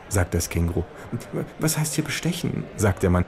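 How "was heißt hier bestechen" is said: high falsetto of a small fat lobbyist. Acted. Lobbyist lecturing the kangaroo at the buffet, high falsetto. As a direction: squeak it out like a nervous little fat man. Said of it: "mit Fistelstimme".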